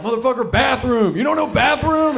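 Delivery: high, incredulous mock shout; hammed-up tourist outrage, pitched high; mock exasperated American. American abroad to a local, mock exasperated.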